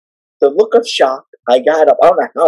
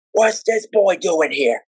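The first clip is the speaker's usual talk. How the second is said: higher, drawn-out wrestling manager voice; slow, raised voice of a stomping manager; Captain Lou; 5 semitones higher, slower than usual. Retold or imitated. Wrestling manager storms up to a young fan, higher slow impression. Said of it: "here comes Captain Lou. Stomping around".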